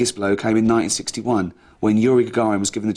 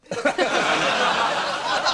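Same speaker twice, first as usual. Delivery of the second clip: high laugh; 10 semitones higher than usual. Real, not acted. Laughter after the punchline.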